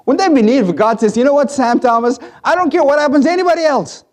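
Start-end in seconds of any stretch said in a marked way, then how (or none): 1.2-4.0 high, fast voice of God speaking. Retold or imitated. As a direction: lift it high and rush as God calling Sam Thomas.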